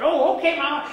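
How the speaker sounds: put-on high child, eager; chirpy obedient child; eager little girl. Little girl agrees to take out trash, high. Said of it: "little girl answers".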